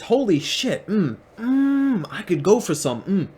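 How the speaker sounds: mock-greedy Jesus, high and savoring; hammed-up craving, with hungry hums. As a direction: ham up a starving Jesus drooling over figs. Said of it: "And he says".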